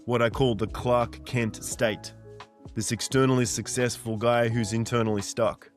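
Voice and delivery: usual speaking voice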